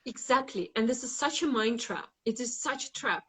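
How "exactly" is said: normal talking voice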